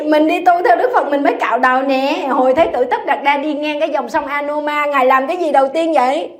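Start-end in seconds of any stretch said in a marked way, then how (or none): none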